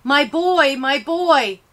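put-on high, loud anguished cry of my boy; mother's wail. A narrator's reading. Mother wailing over her shot son, high and loud. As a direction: voice a grieving mother calling out. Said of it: "She's wailing".

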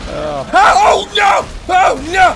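very high, loud wail of oh no; dismayed shout. Real, not acted.

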